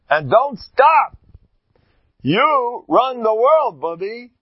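loud, high, very slow rallying call; rally the crowd to never stop; rousing exhortation. Acted. Preacher urging listeners.

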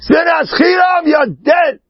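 shouted verdict, high and cracking; outraged shout of judgment; heavenly verdict. Acted. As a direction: thunder down the sentence like a judge. Heavenly court sentencing the soul.

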